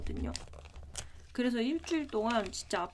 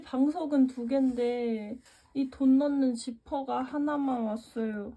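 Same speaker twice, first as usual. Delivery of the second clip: ordinary talk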